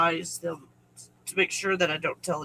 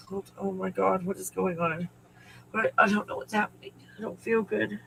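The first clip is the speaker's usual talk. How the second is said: shaken and faint; 5 dB quieter than usual. Real, not acted. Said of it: "I'm not okay".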